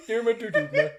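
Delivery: high, loud Muppet voice through laughter; laughing cartoon frog impression; Kermit the Frog. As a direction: pronounce it like a giggling puppet frog. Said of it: "I can hear Kermit the Frog pronouncing that".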